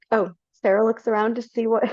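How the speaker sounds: ordinary delivery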